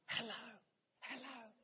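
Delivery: put-on soft, hushed little-child hello; child's whisper. Child answering the boss's call, whispering. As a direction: whisper it like a small child on the phone. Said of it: "a child's voice on the other end whispering".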